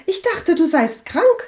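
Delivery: high, loud, aghast; alarmed astonishment. A narrator's reading. Cousin faces visitor she thought was ill, alarmed. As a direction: blurt it out in a high startled voice. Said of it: "Walburga wich entsetzt zurück".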